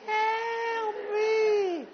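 very high, drawn-out comic wail; hammed-up cry for help. Man grabbed between the legs begs help, mock high shriek. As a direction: imitate a squeezed man wailing for rescue. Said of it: "grabbed me and go".

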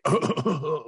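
high, repeated choking noises; imitated choking. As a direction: mimic someone who can't stop choking. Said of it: "It's just like".

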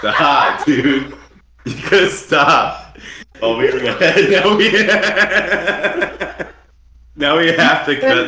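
high, loud, laughing protest. Real, not acted. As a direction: crack up while begging him to stop. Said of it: "laughing protest after friend's joke".

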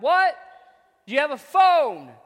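loud, high call back across the field; shouted question. Retold or imitated. Farmer hollering back to the coach, loud and high.

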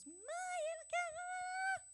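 put-on high, loud, slow child's wail of "Mãe, eu não quero"; whiny child. Preacher voicing a child fighting over a toy, high whining wail. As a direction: stretch it out like a toddler refusing.

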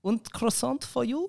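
high, slow put-on German; German accent.

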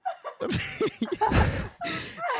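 long squealing laugh; long high laugh. Real, not acted. Laughing at the mishap.

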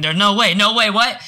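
shouted high, incredulous no way; stunned disbelief. Retold or imitated. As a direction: blurt it out, floored by the news. Disbelief at scam call claim.

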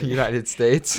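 laughing, slow, high Italian-accented United States; mock Italian accent. Shown as a demonstration. Friends joking about saying United States in Italian, laughing Italian accent. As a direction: stretch United States out with a sing-song Italian rise. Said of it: "In a, in a sentence".

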